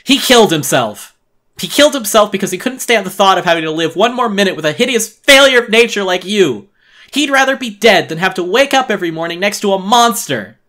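loud, high furious shouting; screaming accusation, full fury; furious explosion. A narrator's reading. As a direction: blast it at her in rage. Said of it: "I exploded furiously".

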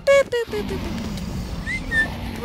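squeaky high booping; high boop noises. Pretend. Mimicking game sounds while playing, high booping. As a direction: boop it out very high, fading to breath.

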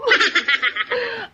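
high giggling laugh. Real, not acted. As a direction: break into a giggle. Laughs at her own accent.

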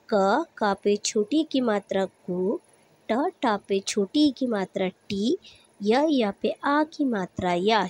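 slow, deliberate syllable-by-syllable spelling. Shown as a demonstration. Teacher spelling a word for children, slow and deliberate. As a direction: spell it out slowly for young learners. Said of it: "spelling syllables for students".

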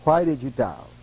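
low tender reproach; gentle rebuke. Retold or imitated. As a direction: say it low, like a loving teacher. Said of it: "that's what he called them".

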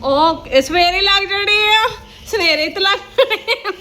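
laughing. Real, not acted. Laughing at son's wish.

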